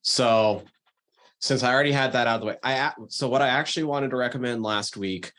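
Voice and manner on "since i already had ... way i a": ordinary talk, nothing marked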